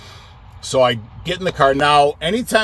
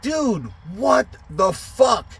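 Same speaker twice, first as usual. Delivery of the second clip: slow, high-pitched exasperated outburst; retold fed-up outburst; angry exasperation; 8 semitones higher, slower than usual. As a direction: draw it out in disbelief. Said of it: "Now I'm fucking getting angry".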